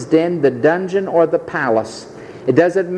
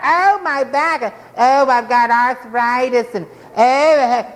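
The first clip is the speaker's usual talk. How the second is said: higher, drawn-out moaning; mock complaining; 6 semitones higher, slower than usual. Preacher imitating complainers in his congregation, mock moaning. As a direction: groan on about your ailments, hammed-up. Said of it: "Those of you complaining and murmuring".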